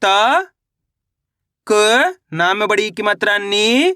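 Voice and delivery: slow, त and क each alone between long pauses, नी drawn out rising; letter-by-letter sounding out. Shown as a demonstration. Teacher showing his method again, slow letter-by-letter sounding out. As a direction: spell out त, क, then a long lifted नी. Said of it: "किस तरीके से एक अक्षर का उच्चारण करके".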